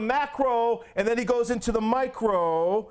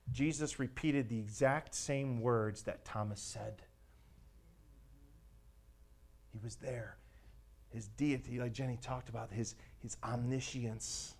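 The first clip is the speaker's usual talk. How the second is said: low, very soft preaching; hushed awe; 8 semitones lower, 11 dB quieter than usual. Real, not acted. Preacher reflects on Jesus knowing Thomas's words, hushed awe. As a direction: let it sink in quietly, pausing between thoughts.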